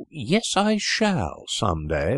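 slow, high-pitched huffy retort; indignant retort. Acted. Sister answering her brother, slow high huffy retort. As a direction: drag it out to spite him. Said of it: "retorted Selina".

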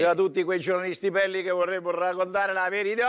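high-voiced Roman premier caricature; Giorgia Meloni. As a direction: climb through a mock-scornful tirade. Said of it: "Presidente Meloni".

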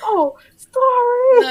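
very high, slow, squeaky through laughter; laugh-broken imitation; laughing mock apology. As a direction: whimper the apology mid-laugh.

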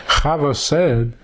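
ordinary talk

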